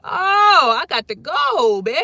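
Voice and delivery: frantic mock escape; panicked exit cry. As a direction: bail out in a hurry.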